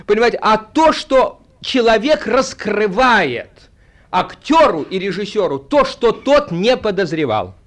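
usual speaking voice

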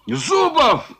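a high called-out name; shout. Acted. Foma calls out Zubov before the merchants, shout. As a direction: call him out sharply. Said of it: "кричал Фома".

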